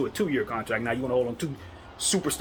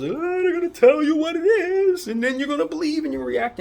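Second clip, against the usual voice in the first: mocking pundit voice; 14 semitones higher, 6 dB louder than usual. Vlogger mocking media pundits who tell fans what to think, high sing-song mock voice. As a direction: ham it up like the pundits feeding fans lines. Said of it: "don't just listen to those".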